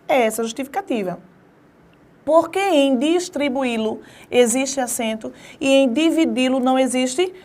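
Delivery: ordinary delivery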